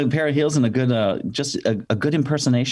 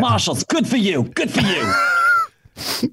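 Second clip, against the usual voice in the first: gushing red carpet chatter, high-pitched; drawn-out mock praise, voice cracking up high; Joan Rivers; 12 semitones higher, much slower than usual. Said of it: "they hired me to be Joan Rivers".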